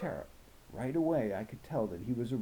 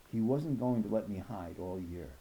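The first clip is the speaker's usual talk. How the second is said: ordinary delivery, nothing marked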